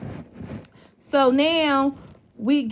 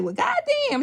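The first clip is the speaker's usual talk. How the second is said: mock shocked; 14 semitones higher than usual. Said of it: "she was like".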